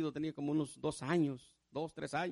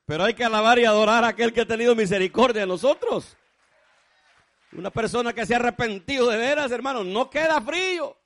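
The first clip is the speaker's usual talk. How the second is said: very loud, high exhortation; full-force preaching; 7 semitones higher, 16 dB louder than usual. Real, not acted. Preacher urging the church to praise God, full-force exhortation. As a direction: belt it out to the whole congregation.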